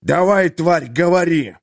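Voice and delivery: loud furious snarl at the prisoner; growled order. A narrator's reading. Merchant threatens the beaten jailer, furious loud growl. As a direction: bark it like a threat. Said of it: "рыкнул торговец".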